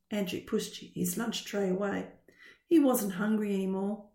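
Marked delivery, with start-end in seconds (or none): none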